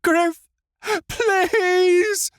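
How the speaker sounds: high, loud desperate plea; begging. A narrator's reading. Tifila begging Griff to spare Wick, loud pleading cry. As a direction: beg him with everything. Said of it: "Tifila begged".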